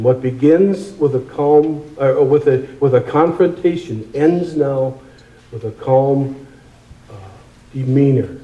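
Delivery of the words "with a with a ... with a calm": usual speaking voice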